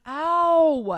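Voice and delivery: loud, high mock ow; pained noise. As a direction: yelp in imitated pain. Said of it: "making the same noises".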